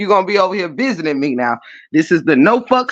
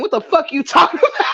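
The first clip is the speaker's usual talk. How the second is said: shouting through real laughter; can barely get it out for laughing; 8 semitones higher, faster than usual. Real, not acted. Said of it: "I'm crying in real life".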